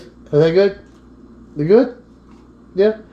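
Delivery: high cooing pet voice; talking to a dog. Real, not acted. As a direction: coo it sweetly.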